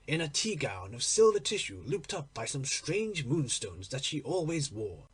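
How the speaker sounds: usual speaking voice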